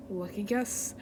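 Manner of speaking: low, soft villain voice; villain take. Acted. Villain conceding a point, low and soft. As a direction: say it low and quietly.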